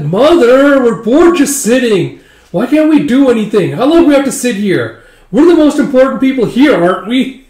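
put-on high, loud childish whine; whiny bored brides. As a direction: whine at mother like spoiled kids. Brides whining to their mother.

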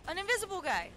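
high shocked cry; startled shriek. Real, not acted. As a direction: shriek in surprise. Scared by invisible enemy.

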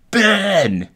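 high, loud shout of a name; calling out. Retold or imitated. Father calling out to his son, high loud shout. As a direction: shout it across a great distance.

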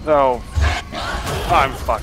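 sudden panicked shout; panicking gamer. Real, not acted. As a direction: yelp it as the monster grabs you. Monster found him, shouts.

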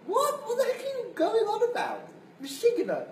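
a baffled laugh of disbelief; mock-amazed uncle with a laugh; incredulous uncle. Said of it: "uncle's baffled reply".

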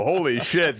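ordinary talk, nothing marked

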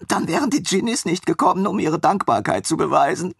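put-on high mumbled voice of the eager young man; mumbling from the floor. A narrator's reading. Lying on the floor, Armin mumbles to his master in a high voice. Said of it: "nuschelte er vom Boden her".

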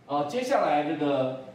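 ordinary talk

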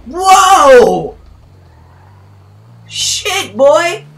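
very loud, high shocked shout; real startled outburst; startled yell. Real, not acted. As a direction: react to a sudden crash. Crash surprise.

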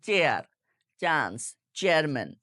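চেয়ার, চান্স, চেয়ারম্যান with a hard Bengali চ, no puff of air; unaspirated ch. Shown as a demonstration. Teacher shows the common mistake, চেয়ারম্যান with unaspirated চ. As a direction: local mispronunciation of চেয়ার, চান্স, চেয়ারম্যান with plain চ. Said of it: "আমরা চ দিয়ে বলে ফেলি".

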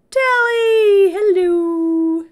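hammed-up high old-lady greeting; Mrs Doubtfire voice. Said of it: "That sounded like Miss Doubtfire".

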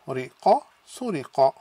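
"ri, qa" separate, then "suriqa" in one sweep; syllable by syllable. Shown as a demonstration. Teacher sounding out a word for students. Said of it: "Then we have su".

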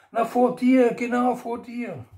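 high voice, slowing on genau vor dir; Rosi's voice. A narrator's reading. Wife shows husband the drill, high and patient. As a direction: lift it high and spell it out.

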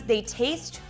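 ordinary talk, nothing marked

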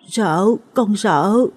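put-on slow, frightened small child voice; stammering. A narrator's reading. Small boy tells his father he's afraid, stammering. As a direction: draw the words out fearfully, like a scared child. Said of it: "Mít lắp bắp".

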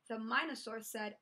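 ordinary delivery

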